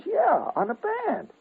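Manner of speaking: very high, slow matter-of-fact; slow high confirm. Retold or imitated. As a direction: spell it out like it's obvious. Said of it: "He says".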